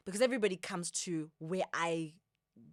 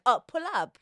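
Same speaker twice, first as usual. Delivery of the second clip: higher, louder mock invitation; excited call-out; 5 semitones higher, 10 dB louder than usual. Guest voicing South Africans' love of pulling up, excited call-out. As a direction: toss it out as an eager call.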